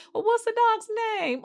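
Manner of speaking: squeaky high imitation of Carisha; high mock friend voice. Recapping Carisha's question to Brittany, high mock voice. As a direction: pitch it way up as the curious friend.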